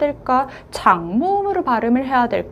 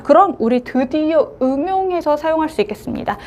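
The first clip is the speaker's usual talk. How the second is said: ordinary talk, nothing marked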